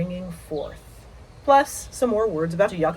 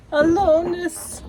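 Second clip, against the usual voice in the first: "Aloneness" repeated much higher in a changed voice; dreamy echo; 9 semitones higher than usual. Shown as a demonstration. Echoing the prompt word "Aloneness", high and dreamy. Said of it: "That's a very Rilke word".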